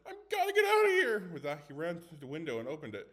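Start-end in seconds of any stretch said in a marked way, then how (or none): 0.1-1.3 panicked yell. Acted. Panicking before jumping.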